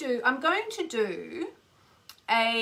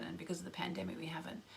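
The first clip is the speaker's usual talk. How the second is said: whispered, longing aside; wistful whisper; 4 semitones lower, 14 dB quieter, faster than usual. Real, not acted. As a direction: breathe it out wistfully. Wistful aside while guiding.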